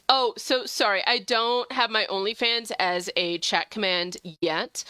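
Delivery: usual speaking voice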